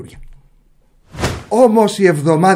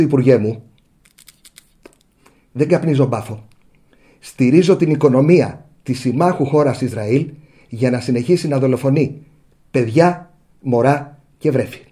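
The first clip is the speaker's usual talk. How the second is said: model journalist's answer; 4 semitones lower than usual. Acted. Journalist answering the minister as he deserves, deadpan and deliberate. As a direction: address the minister gravely, phrase by phrase. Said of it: "του απαντάς όπως πρέπει, δηλαδή έτσι".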